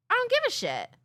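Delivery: high scornful mocking voice; mocking commenter. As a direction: sneer it up high like a jerk.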